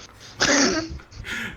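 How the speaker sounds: bright high-pitched laughter. Real, not acted. Laughs at the teasing.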